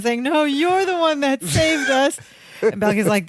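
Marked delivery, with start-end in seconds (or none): 0.2-2.2 high-pitched weepy wail, imitated; mock crying. Imitating Larry rejecting his cousin's praise, crying. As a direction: wail it like a comic crybaby. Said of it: "Then he starts crying again".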